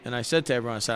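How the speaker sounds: ordinary talk, nothing marked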